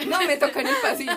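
laughing. Real, not acted. Telling friends she got the aisle seat, laughing. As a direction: crack up while telling it.

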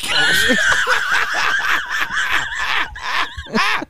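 howling high laugh. Real, not acted. Friends cracking up at mishearing.

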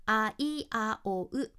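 あいあおう sung out very slowly, each vowel separate; vowels only. Shown as a demonstration. Showing listeners the vowels of ありがとう, very slowly. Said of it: "口の形をしっかり母音の形に".

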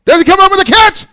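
loud high live call; excited play-by-play. Acted. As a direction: shout the catch attempt.

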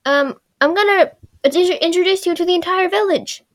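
high, loud put-on character voice; Katara impersonation. Katara telling Aang he'll meet the village, high put-on voice. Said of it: "But then Katara comes in".

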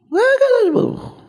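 very high ringing cry; soaring preacher's cry; high proclamation. Real, not acted. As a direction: shout it to the heavens. Climactic proclamation.

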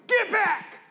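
high, forceful command; commanding yell. Retold or imitated. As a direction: drive the dog off with a yell. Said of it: "And then we go".

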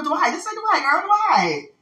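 high-pitched, over-careful "Dwight"; trying too hard. Real, not acted. Struggling to say his name right, trying too hard. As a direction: push the name up high, overdoing it. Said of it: "trying too hard to say it right".

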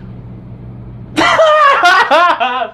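long, high burst of laughter; loud real laugh. Real, not acted. Teller laughing hard at his own awkward kiss request. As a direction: crack up loudly at yourself.

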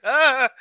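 loud, high mock yelp of alarm; bigger startled cry imitation. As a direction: imitate a creature's frightened "ah". Imitating Gizmo, second bigger scare.

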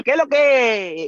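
high, loud, stretched-out mock call; hammed-up slang greeting. Newcomer showing the slang has stuck, hammed-up greeting. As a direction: holler it like a playful local greeting.

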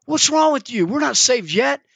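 put-on a kid baffled by grown-ups; little boy scolding the men; exasperated boy. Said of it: "the boy's looking at this guy like".

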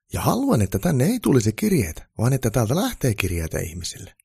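high, loud keen elf voice; eager reformer elf. A narrator's reading. Elf pitching reforms to Santa, high and eager.

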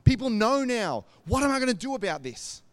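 imagine everyone knows, rising alarm; panicky thought. Retold or imitated. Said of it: "when we panic".

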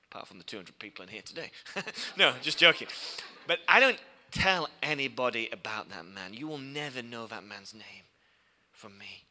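ordinary delivery

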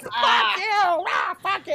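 put-on high yelled curses in a child's voice; imitating the son yelling in the car, high and loud; kid yelling. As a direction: scream it like a furious five-year-old. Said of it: "Odie just starts yelling".

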